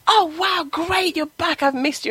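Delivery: high, excited greeting from the dog. Retold or imitated. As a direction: gush like a thrilled pup.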